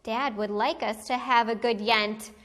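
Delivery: plain reading voice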